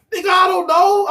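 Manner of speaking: fed-up outburst; exasperated shout. Real, not acted. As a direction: blow up at the question.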